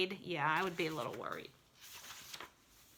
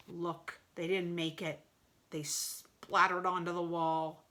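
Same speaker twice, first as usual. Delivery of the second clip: plain reading voice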